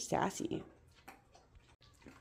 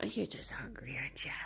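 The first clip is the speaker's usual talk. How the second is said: soft whisper; 4 semitones lower, 8 dB quieter, faster than usual. Real, not acted. Whispering to the dog.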